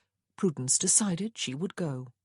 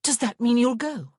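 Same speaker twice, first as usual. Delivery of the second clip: loud, high eager question; delighted; 7 semitones higher, 7 dB louder than usual. A narrator's reading. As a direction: ask with breathless delight. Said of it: "gasped with delight".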